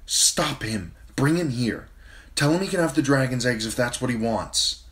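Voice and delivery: anxious queen giving rapid commands; commanding. A narrator's reading. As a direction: press the knight to act now. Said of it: "she commanded Ser Jorah".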